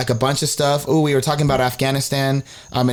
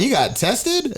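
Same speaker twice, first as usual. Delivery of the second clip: high shocked question; 11 semitones higher, slower than usual. Retold or imitated. Retelling shock at friend.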